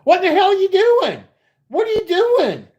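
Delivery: put-on high, loud shrill-edged yell of a drunk woman; hammed-up screaming woman; retold scream. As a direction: mimic her screaming at you. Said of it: "She's frickin' screaming".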